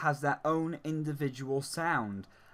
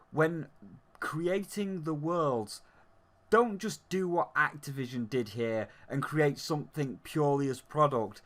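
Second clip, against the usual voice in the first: ordinary talk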